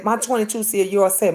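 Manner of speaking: ordinary talk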